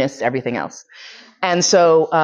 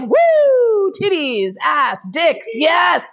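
a much higher, whooping party yell; whooping excited friend; 10 semitones higher, much slower than usual. Retold or imitated. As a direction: cheer like a guy who just won the jackpot. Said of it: "He's just like".

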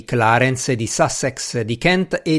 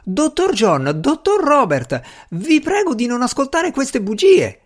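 high, pleading servant voice; protesting servant; 13 semitones higher, 3 dB louder than usual. A narrator's reading. Servant begging the doctors to ignore lies, protesting. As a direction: plead in a much higher voice. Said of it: "gridò".

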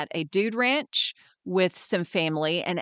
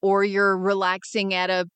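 normal talking voice

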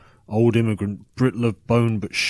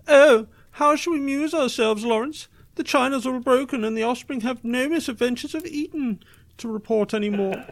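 much higher, sneering toff voice; mock posh toff. Storyteller mocking bored drunk listeners as posh toffs. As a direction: lampoon a bored aristocrat, hammed-up.

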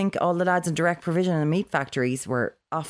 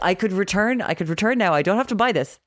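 imagined inner panic; 6 semitones higher than usual. Retold or imitated. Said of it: "you're like".

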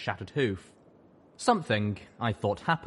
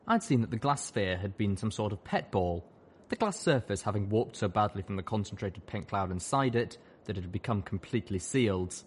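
usual speaking voice

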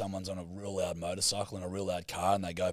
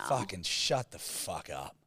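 normal talking voice

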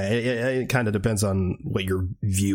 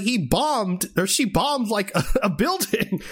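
higher mocking hero voice, a laugh slipping in; mock Steve; 10 semitones higher than usual. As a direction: lampoon his excuses, pitched up. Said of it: "he's like".

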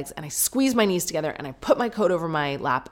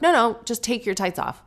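pushy, brushing off objections, pitched up; higher-voiced mock bullying; bullyish; 6 semitones higher than usual. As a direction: imitate a bossy salon worker insisting. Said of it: "they're quite bullyish".

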